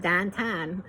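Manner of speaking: "downtown" in Pittsburghese, vowels flattened to "dahntahn". Acted. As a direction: say "downtown" the Pittsburgh way, like "dan-tan". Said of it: "She corrected my Pittsburghese pronunciation".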